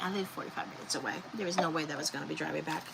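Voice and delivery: quick hushed brush-off; rushed dismissive reply. Retold or imitated. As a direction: rattle it off quietly, no way. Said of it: "I'm like".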